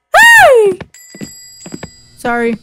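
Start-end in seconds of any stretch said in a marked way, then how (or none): 0.1-0.8 high, loud yell; sudden shout. Real, not acted. Clerk yelling at a customer, high loud shout. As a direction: yell to stop someone.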